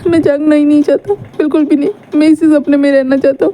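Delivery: cling to the dream through tears; tearful plea. Acted. Boy tells his love he won't wake, teary. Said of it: "रो रहा था".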